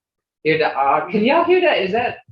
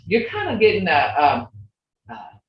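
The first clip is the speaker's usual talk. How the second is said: usual speaking voice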